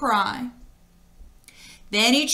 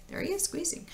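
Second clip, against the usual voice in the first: whispering; 7 semitones higher, 13 dB quieter, faster than usual. Real, not acted. Aside pointing at picture.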